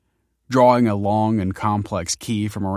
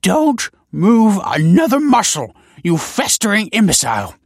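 furious scholar; 13 semitones higher, 8 dB louder than usual. A narrator's reading. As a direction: berate him in a seething scholarly voice.

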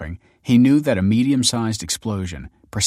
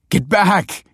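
loud, high urgent plea; begging; 11 semitones higher, 6 dB louder than usual. A narrator's reading. Man shaking friend off near explosion, begging shout. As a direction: beg them to move away. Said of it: "he begged".

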